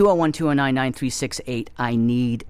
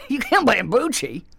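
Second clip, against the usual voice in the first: laughing while excusing himself; 9 semitones higher than usual. Real, not acted. Comic laughing at himself. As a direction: laugh through the line.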